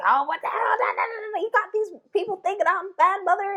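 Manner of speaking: high, fast mock scolding mother; a hammed-up angry mom; imitating her mother. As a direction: throw it out like an exasperated mother. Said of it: "that's exactly the way she would have said it to me".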